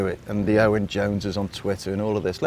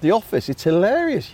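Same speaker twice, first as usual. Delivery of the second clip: amused quote; 7 semitones higher than usual. Retold or imitated.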